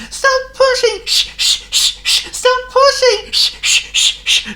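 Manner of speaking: very high, loud panting cries; panting engine. Acted. Thomas panting at the trucks to stop pushing. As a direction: gasp it out between puffs. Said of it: "Thomas panted".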